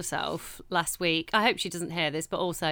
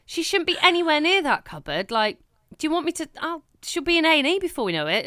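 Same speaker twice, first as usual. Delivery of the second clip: higher, faster telling-off; exasperated scolding; 6 semitones higher than usual. Retold or imitated. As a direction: scold mum, pace climbing at the end. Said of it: "I said".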